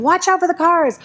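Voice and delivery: anxious shout of warning, pitched high; pretend shouting. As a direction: call out a nervous warning to a husband on a bike. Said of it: "start shouting to your husband".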